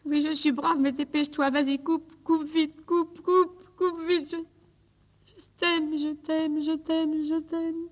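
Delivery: high, rising, desperate "coupe vite" and "je t'aime"; breaking farewell. Acted. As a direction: voice climbing into anguish as she begs him to hang up. Begs lover to hang up quickly.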